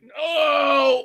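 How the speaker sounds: high, drawn-out mock wail of no; mock anguished cry. Imagining the wrestler hearing the chant catch on, mock wail. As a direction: cry out in hammed-up dismay. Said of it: "he's gonna be like".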